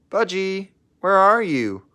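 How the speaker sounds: high, loud call across the hangar. Acted. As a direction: call out for a lost friend. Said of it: "called his friend Pippa".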